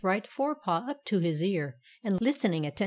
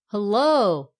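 loud "Hello!" with a long falling second syllable; cried-out hail; 8 dB louder than usual. A narrator's reading. Righty hailing the poker in the clouds, crying out. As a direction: call out "Hello!" loudly, stretching the end. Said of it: "cried the right hand iron".

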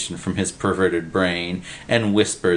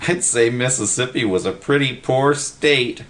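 higher-pitched simple pal; wisecracking Willie; 5 semitones higher than usual. A narrator's reading. Willie answers Freddy's boast, wisecracking. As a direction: lightly deliver the comeback.

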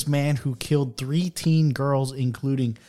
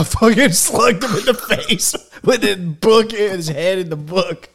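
slow, higher, laughing through; 4 semitones higher, 3 dB louder than usual. Real, not acted. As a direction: tell it while laughing. Laughing while telling.

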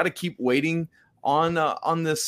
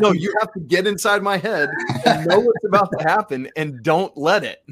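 laughing plea; 6 semitones higher, 4 dB louder than usual. Real, not acted. Laughing plea after botched accent.

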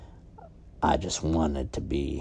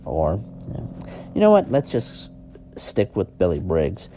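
loud, higher show-host voice over music; booming announcer outro; announcer sign-off; 6 semitones higher, 8 dB louder than usual. Acted. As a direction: wrap up the segment like a TV host.

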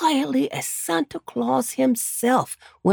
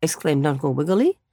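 ordinary delivery

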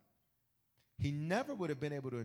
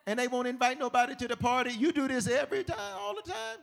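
higher, excited king raving about him; Pharaoh raving; 12 semitones higher, 7 dB louder than usual. Retold or imitated. Pharaoh praising Joseph's gift, higher and excited. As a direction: gush like a king who's hooked. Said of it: "Pharaoh's like".

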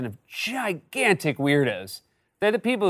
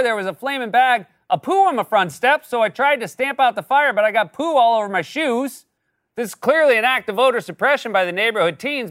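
whiny senator parody; 8 semitones higher than usual. Acted. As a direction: whine like an aggrieved senator. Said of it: "parodying Ron Johnson".

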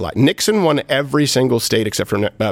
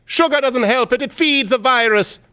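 much higher-pitched put-on Jamaican accent; mock Jamaican accent; 12 semitones higher than usual. Scolding about colds and sugar, high mock Jamaican accent. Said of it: "say it slowly in a Jamaican accent".

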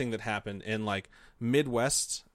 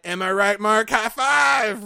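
high, loud bro cheer; mock cheer; 8 semitones higher, 6 dB louder, slower than usual. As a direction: cheer like a wingman. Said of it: "And I put".

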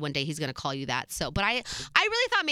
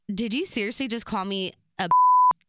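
loud, disbelieving challenge; loud incredulous retort; retold confrontation; 3 semitones higher, 7 dB louder than usual. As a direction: demand it of him, outraged. Confronting boyfriend over insult.

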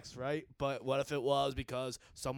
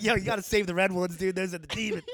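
hyped churchgoer voice; 5 semitones higher, 7 dB louder than usual. Retold or imitated. Voicing Christians, excited.

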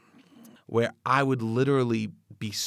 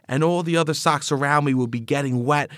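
ordinary talk

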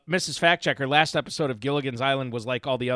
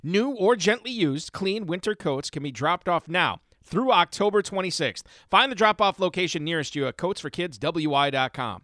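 ad read; 4 semitones higher than usual. Acted. Reads charity ad on air.

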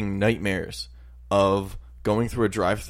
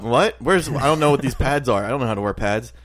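ordinary delivery

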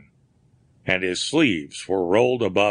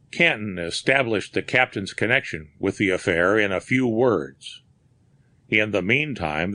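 ordinary delivery

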